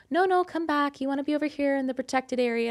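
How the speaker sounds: high, coaxing shaman voice; put-on high voice of a concerned helper; imitated shaman. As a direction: call someone back in a high sing-out. Shamans calling her back.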